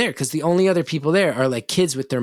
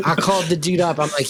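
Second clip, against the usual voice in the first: ordinary talk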